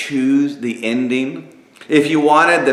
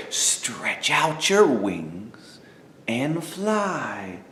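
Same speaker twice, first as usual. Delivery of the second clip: slow, much softer inner voice; 3 semitones lower, 8 dB quieter, much slower than usual. A narrator's reading. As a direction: let it fade like a voice inside. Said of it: "He heard the same voice say".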